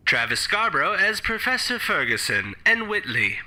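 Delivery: normal talking voice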